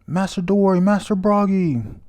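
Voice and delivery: high, loud pleading scream. Retold or imitated. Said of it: "He's screaming towards them".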